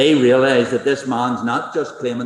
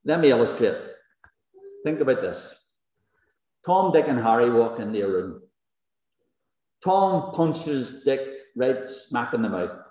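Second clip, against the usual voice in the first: ordinary talk, nothing marked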